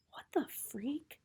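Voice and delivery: hushed, breathy whisper in the dark; startled whisper at night. Acted. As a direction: whisper it so no one hears. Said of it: "I whisper".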